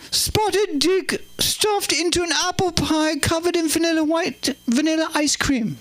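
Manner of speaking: high regal menu reading; high-pitched, stately monarch impression; the Queen. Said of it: "We'll do the Queen tonight".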